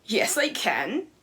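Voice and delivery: slow, loud stern warning; stern teacher threat. Retold or imitated. Teacher threatening the defiant student, slow and loud. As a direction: lay down the law, slowly.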